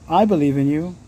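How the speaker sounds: usual speaking voice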